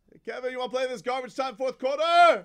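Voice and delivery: high-pitched put-on coach voice; mock coach's call-out. Coach offering Kevin garbage time, high mock voice. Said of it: "and then goes".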